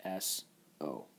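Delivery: ordinary talk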